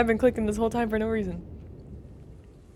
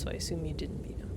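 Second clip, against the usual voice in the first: quick teasing whisper; 3 semitones lower, 6 dB quieter, faster than usual. Real, not acted. Teasing aside to chat.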